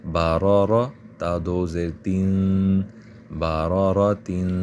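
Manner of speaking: "بارارا" with a full heavy ra, "تین" drawn out slowly; slow careful spelling. Shown as a demonstration. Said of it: "موٹا پڑھا جائے گا". ("را" موٹا پڑھیں، "بارارا تین" آہستہ)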